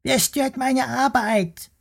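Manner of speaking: very high, slow squeaky worm voice demanding; cross little worm. Acted. Worm scolds the bear for disturbing him, squeaky and cross. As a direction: scold like a tiny fussy creature.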